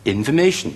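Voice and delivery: information輕輕讀，中間for弱成fə; 輕巧帶過information中間嘅音; 輕巧弱音. Shown as a demonstration. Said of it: "好輕巧過嘅". (輕聲啲示範information，弱音好短)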